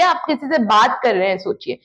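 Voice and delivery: usual speaking voice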